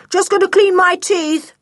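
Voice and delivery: cheeky sing-song call; boy calling back as he escapes; calling out cheekily. A narrator's reading. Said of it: "he called".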